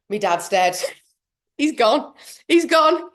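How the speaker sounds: laughing, escalating fake panic; mock panic. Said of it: "So I'm panicking".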